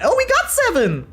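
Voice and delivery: real burst of glee at the lucky roll; thrilled yelp over a lucky drop; excited cheer. Real, not acted. Lucky game result.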